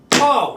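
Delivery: high, loud mock hammer smack; loud playful pow for the hammer strike; loud pow sound. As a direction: shout the hit like a comic-book punch. Hammer hitting the blocks.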